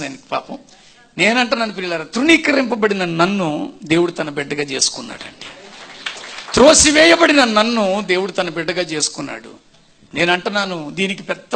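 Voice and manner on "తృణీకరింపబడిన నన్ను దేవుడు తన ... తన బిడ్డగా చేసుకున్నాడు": loud, emphatic preaching; rising preacher's proclamation; preaching declaration. Acted. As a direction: proclaim it to the congregation with force.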